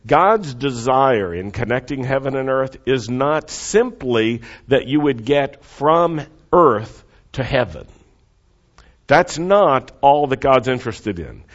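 usual speaking voice

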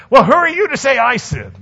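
high, loud indignant comeback; defensive retort. Retold or imitated. Pastor mocking the congregation's reaction, indignant. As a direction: bristle back at being called a sinner. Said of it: "our first response is".

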